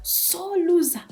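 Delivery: high, slow singsong taunt; slow, high mocking introduction; mock announcement. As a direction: draw it out high like a teasing announcer.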